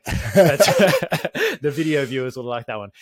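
can't get through it without cracking up; laughing. Real, not acted. Laughing to his co-host about the video viewers. Joke about bench pressing.

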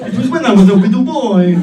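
loud, lightly put-on blocked-nose voice; whiny broken nose voice. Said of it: "stupid broken nose voice".

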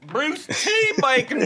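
high, slow goofy announcement; silly high voice. Pretend. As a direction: stretch the name out comically. Echoing name in silly voice.

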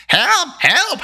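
high creature howl for help; Gurgi-like yelp of alarm. A narrator's reading. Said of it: "howled Gurley, Gurgi".

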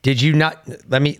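normal talking voice

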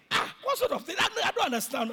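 high, loud burst of indignation; exasperated outburst. Real, not acted. Pastor fed up with excuses about masks, exasperated outburst. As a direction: cry out in sheer exasperation. Exasperated at people blaming the government.

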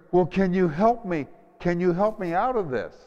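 high, loud begging, speeding up; desperate plea. Retold or imitated. Man begging God for help.

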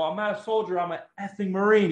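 high, indignant correction. Retold or imitated.

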